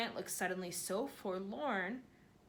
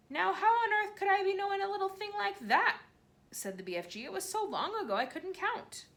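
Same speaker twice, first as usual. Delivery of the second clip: much higher, louder incredulous giant; giant voice, exasperated; 11 semitones higher, 5 dB louder than usual. A narrator's reading. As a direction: giant baffled by a silly question. BFG voice held in dialogue.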